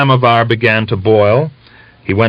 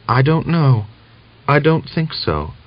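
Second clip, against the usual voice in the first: higher voice of a sick wife on the mend; relieved but frail, pitched up; weak young woman; 8 semitones higher than usual. A narrator's reading. Natalia answers.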